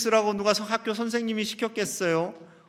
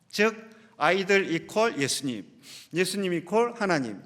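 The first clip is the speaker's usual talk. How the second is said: ordinary talk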